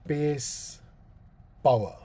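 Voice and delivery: normal talking voice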